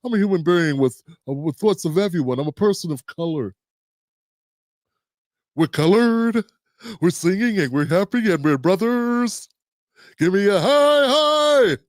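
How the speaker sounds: a lightly voiced, cheesy progressive, mildly mocking. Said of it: "a progressive would be like".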